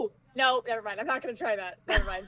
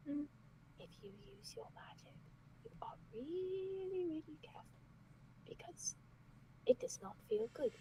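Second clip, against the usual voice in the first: hushed warning; 5 semitones higher, 17 dB quieter than usual. Acted. Warning the group in huddle.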